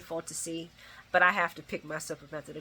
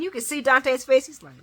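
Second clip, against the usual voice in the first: usual speaking voice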